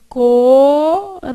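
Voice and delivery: "coração" slowly stretched as co, ra, ção; syllable by syllable. Shown as a demonstration. Teacher shows class the split, syllable by syllable. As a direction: separate each syllable of "coração" with a pause. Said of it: "Você fez".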